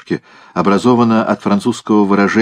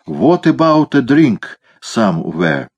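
American English; 4 semitones higher, slower than usual. Acted. American soldier speaks English.

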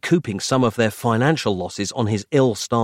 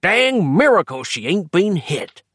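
put-on old man voice; 4 dB louder than usual. A narrator's reading. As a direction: play a rambling old timer.

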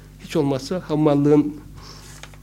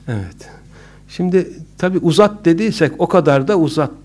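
normal talking voice

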